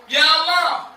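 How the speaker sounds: loud, high cry to God; acted impassioned plea; fervent prayer. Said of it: "Dia berdoa".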